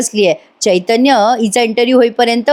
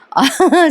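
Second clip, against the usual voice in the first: short high giggle; amused laugh; 6 semitones higher than usual. Real, not acted. Narrator tickled by scented eraser cravings, amused giggle. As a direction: giggle briefly at the excuse.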